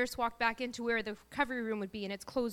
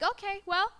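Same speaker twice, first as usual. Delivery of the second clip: higher, lightly acted casual phone goodbye; breezy sign-off; 8 semitones higher than usual. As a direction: toss it off like an everyday call. Acted casual phone reply to husband.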